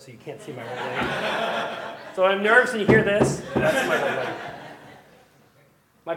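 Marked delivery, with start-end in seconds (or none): 0.0-4.3 laughing through the words. Real, not acted. Pastor telling congregation about nerves, laughing. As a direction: chuckle at your own shaking leg.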